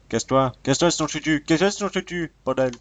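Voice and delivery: high, loud, escalating threat; menace énervée. Real, not acted. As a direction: drive it away, pitch climbing with each repeat. Threatens a mob getting in way. (cris de menace exaspérés, de plus en plus aigus)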